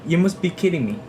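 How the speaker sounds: high-pitched surprised exclamation. Shown as a demonstration. Student showing classmates an expression of surprise, surprised. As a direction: exclaim it in disbelief, pitch well up. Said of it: "Something like that".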